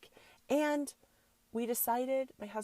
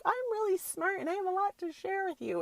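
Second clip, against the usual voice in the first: much higher, pleading mom voice; mock pleading mom; 11 semitones higher than usual. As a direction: plead to your son to be heard. Pleading with her son.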